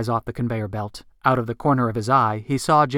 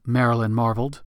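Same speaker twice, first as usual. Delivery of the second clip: ordinary delivery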